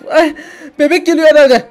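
high, loud panicked cry; sudden alarmed yell; labor panic. Acted. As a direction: shout as the contractions hit. Pregnant mother's labor starts.

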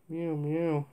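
a seasick cat mewing; pitiful meow. Acted. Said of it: "agreed Hamish pitifully".

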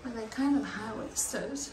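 hushed, close to the mic; quiet voice. Real, not acted.

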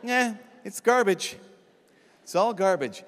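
drawn-out mock indifference; indifferent. Imagined garbage man shrugging off the trash, indifferent. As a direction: shrug it off slowly, acting unbothered. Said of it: "they don't care. They're just like".

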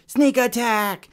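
high, loud war cry, drawn out slowly; gleeful yell. Retold or imitated. Friend ambushing me at the water fight, loud gleeful yell. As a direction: leap out with a playful battle shout. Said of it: "and he yells".

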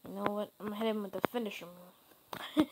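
whispered plan, voice dropped out completely. Real, not acted. Player quietly plotting a finisher on Brock Lesnar, whispering. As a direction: whisper it like a secret scheme. Sneaky plan against opponent.

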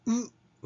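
"う" with fully rounded lips; tightly rounded vowel. Shown as a demonstration. Teacher breaking down wood, tightly rounded "う". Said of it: "思いっきり唇を丸めて".

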